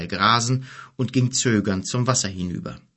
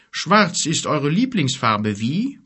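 grumbling giant; 5 semitones higher, slower than usual. A narrator's reading. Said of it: "brummte Gorg".

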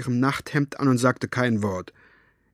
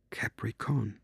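barely audible, very soft; whispered name; 2 semitones lower, 11 dB quieter, slower than usual. Acted. Girl whispering the feared name to her aunt. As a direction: breathe the name as if it were dangerous. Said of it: "Maggie flüsterte den Namen nur".

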